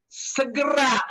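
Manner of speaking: normal talking voice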